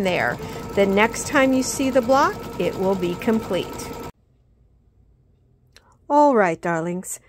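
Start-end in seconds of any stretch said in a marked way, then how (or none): 6.1-7.2 a light British "All right, darlings"; greeting viewers in an accidental British accent. Real, not acted. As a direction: slip into a chirpy British accent. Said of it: "I just started talking in a British accent".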